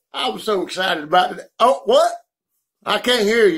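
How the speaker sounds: ordinary talk, nothing marked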